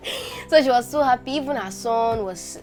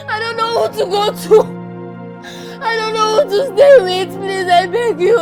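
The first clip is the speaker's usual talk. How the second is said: louder, tearful sobbing pleas; orphaned child sobbing to his mother; crying and begging; 2 semitones higher, 3 dB louder than usual. Acted. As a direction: beg her through tears not to leave.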